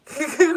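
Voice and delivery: short, high, loud giggle; short laugh. Real, not acted. Reader amused by the gift-instead-of-talking idea, short laugh. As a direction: let out a quick loud laugh. Laughs at own quoted thought.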